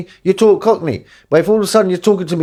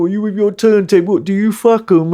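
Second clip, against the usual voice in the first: higher-pitched imitation of teasers; mock taunting, pitched up; 5 semitones higher than usual. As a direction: jeer like people making fun. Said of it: "everybody used to make fun of me".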